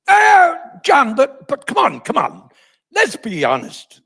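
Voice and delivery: high, loud wail of protest; loud, high-pitched "oh, come on" coaxing; mock cajoling host. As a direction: imitate an interviewer pressing with exaggerated disbelief. Host turns on guest after break.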